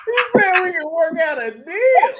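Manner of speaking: loud, high, slow, laughing through the words; cracking up while repeating the punchline. Real, not acted. Repeating his friend's joke while laughing loud.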